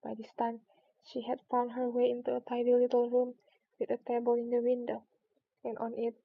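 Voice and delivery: ordinary delivery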